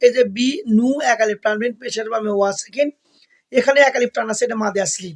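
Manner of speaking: ordinary talk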